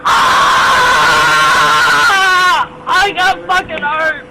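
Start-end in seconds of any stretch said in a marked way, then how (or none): 0.1-2.7 loud raw scream of pain. Real, not acted. Man bitten by police dog screams in pain. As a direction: scream as if hurt.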